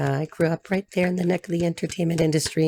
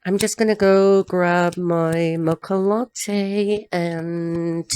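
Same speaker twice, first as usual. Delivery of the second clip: very slow, drawn-out put-on voice; imitating her talk; much slower than usual. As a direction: stretch every word, playing her. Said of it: "she may talk like this".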